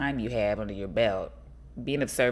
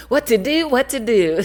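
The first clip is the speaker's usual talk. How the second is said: high, loud, playful cry; playful exclaiming; 11 semitones higher, 9 dB louder than usual. Real, not acted. Vlogger joking about free time, loud playful exclaiming. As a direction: call it out high and amused. Playful rhetorical question, amused.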